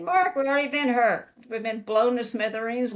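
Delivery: a laughing comeback to Mark. Real, not acted. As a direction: say it still laughing. Laughing retort to Mark's fear.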